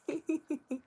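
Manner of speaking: high embarrassed giggle; short giggle at yourself. Real, not acted. Laughing at own hiccups.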